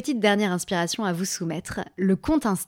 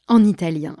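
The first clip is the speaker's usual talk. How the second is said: ordinary talk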